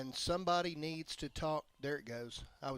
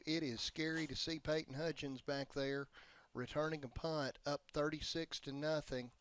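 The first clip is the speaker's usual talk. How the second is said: ordinary delivery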